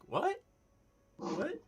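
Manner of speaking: very high, puzzled what; confused squeak. Acted. Menredin looking around confused, squeaking very high. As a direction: squeak it out, lost and baffled. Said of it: "just looking around like".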